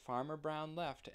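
plain reading voice

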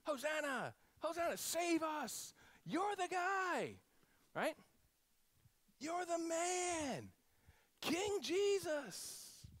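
high, slow, drawn-out hails; adoring crowd cry. Retold or imitated. Crowd hailing Jesus as king, high drawn-out adoring cries. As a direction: cheer the king, stretching each word. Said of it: "these people that are shouting".

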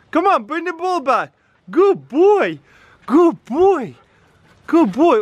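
high-pitched pet talk, swooping down; real high singsong dog praise; calling the dog. Real, not acted.

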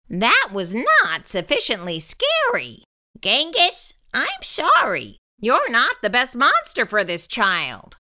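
low stern monster teacher. A narrator's reading. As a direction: let him down like a strict teacher. Mister Z voiced.

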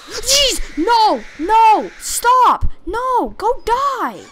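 real jump-scare shrieks, high and very loud; panicked screaming. Real, not acted. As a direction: scream in fright at the thing to back off. Startled by scare in horror game.

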